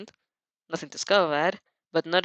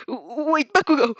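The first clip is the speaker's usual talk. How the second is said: shriek; 5 semitones higher, 5 dB louder, slower than usual. Acted. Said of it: "Mineta shrieks".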